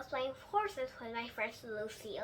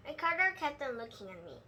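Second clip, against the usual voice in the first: ordinary delivery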